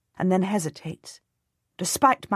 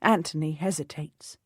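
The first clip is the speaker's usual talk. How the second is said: ordinary delivery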